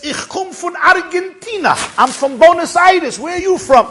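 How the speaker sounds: high, loud voiced old Argentinian; old man's loud chatter. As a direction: call it out high and insistent. Old man accosts the rabbi.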